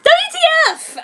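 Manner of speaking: very high, loud furious outburst; furiously. A narrator's reading. Heroine learns Kishu is an alien, furious shout. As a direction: explode at him in fury. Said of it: "I said furiously".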